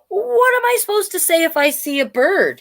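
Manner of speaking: a hint of a confused little dog; storybook dog, lost and puzzled; puzzled dog Woof. A narrator's reading. Woof asks the pig.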